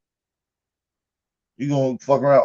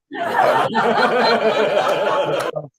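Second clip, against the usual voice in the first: a high, wheezing burst of laughter; real helpless laugh; 7 semitones higher than usual. Real, not acted. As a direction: break up laughing at the joke. Laughing at his own joke.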